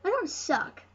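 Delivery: ordinary delivery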